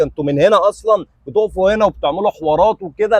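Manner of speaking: ordinary talk, nothing marked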